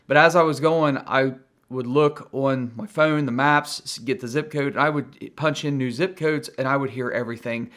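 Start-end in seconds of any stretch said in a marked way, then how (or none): none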